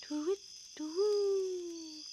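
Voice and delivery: high slow hooting; owl hoot. A narrator's reading. Narrator hooting the owl at bedtime.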